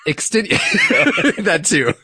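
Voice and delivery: breaking into laughter mid-word; giggling through the words; laughing. Real, not acted.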